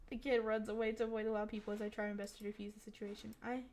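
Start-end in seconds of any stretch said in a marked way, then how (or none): none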